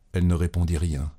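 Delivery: usual speaking voice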